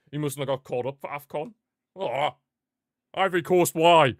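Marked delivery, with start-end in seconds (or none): 1.9-4.1 loud, high cry of protest; shouted, aggrieved disbelief; exasperated outcry. Real, not acted. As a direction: wail a complaint at the team. Protests a player's call-up.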